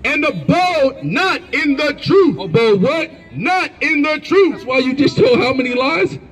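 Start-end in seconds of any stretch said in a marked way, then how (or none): 0.0-2.4 high, slow scripture reading; scripture declamation. Real, not acted. Reader proclaiming scripture at an opponent in debate, declamatory. 3.4-4.5 high, slow scripture reading; scripture declamation. Real, not acted. Reader proclaiming scripture at an opponent in debate, declamatory.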